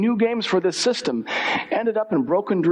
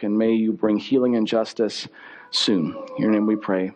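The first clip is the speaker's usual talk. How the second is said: low, even pastoral prayer voice; congregational prayer; 7 semitones lower than usual. Real, not acted. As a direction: lead the room in a low, earnest prayer.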